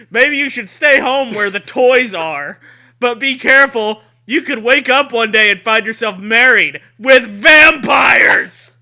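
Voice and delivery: loud, high spooky trailer voice; hammed-up promo shout. Host shouts the blurb like a horror trailer. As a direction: belt it like a campy horror trailer.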